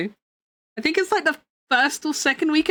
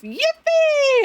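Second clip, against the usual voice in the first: high excited Yippee; high squeal of joy; 13 semitones higher, 3 dB louder than usual. Real, not acted.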